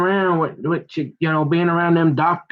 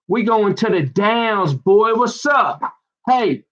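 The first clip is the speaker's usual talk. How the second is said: hollered taunt; 5 semitones higher, slower than usual. Acted. Acted taunt, calling out.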